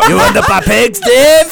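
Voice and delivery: high, laughing put-on voice; loud, giggly mock accent; mock accented villager voice. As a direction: ham up a creepy rural accent, breaking into laughter.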